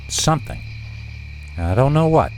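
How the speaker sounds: ordinary delivery, nothing marked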